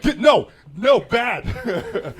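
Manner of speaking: loud, high-pitched telling-off; shouted scolding. Acted. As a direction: shout as if scolding a misbehaving dog. Acts someone snatching food away, scolding.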